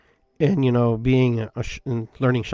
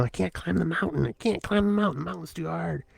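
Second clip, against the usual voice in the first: whiny self-doubt; 6 semitones higher, 5 dB quieter than usual. Retold or imitated. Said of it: "I'm fighting myself".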